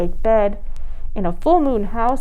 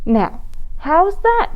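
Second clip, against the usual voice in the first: gentle mother; 8 semitones higher, slower than usual. Acted. Mother shows the ribbon.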